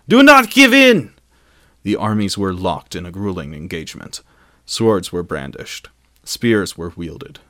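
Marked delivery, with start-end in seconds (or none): 0.1-1.1 high-pitched, full-force rallying shout; desperate yell. A narrator's reading. Elven commander urges locked armies on, yelling desperately. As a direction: scream the order across a battlefield. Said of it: "Celebrimbor yelled desperately".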